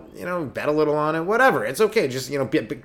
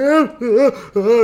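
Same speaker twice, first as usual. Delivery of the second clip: mock child crying; 11 semitones higher, 9 dB louder, slower than usual. Kid crying.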